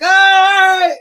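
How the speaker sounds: loud, very high mock reply; exaggerated agreement. Retelling his reply to the vendor, exaggerated high agreement. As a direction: send it soaring up, hammed-up.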